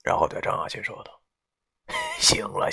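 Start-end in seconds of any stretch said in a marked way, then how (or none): 2.0-2.7 laughing. A narrator's reading. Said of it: "哈哈地笑了这一声".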